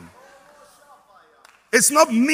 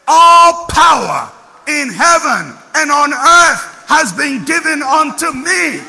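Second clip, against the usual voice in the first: slow, louder and higher declaration; proclaimed quote; 5 semitones higher, 9 dB louder, slower than usual. Retold or imitated. Preacher quoting risen Christ, slow loud proclamation. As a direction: declare it with full authority.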